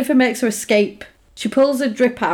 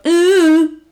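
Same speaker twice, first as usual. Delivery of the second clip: high, loud sneering ooh; mocking ooh; 6 semitones higher, 6 dB louder than usual. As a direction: taunt her with a jeering ooh. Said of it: "two girls go past her and just go".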